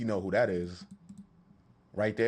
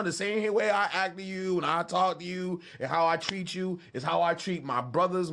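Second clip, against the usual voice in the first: ordinary delivery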